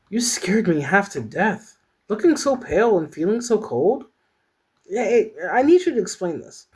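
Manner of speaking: high-pitched character voice; high, insistent friend demanding answers; worried, scolding friend. Acted. Worried Clarice confronts Peyton.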